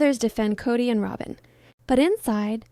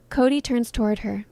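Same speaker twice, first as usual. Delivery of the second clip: plain reading voice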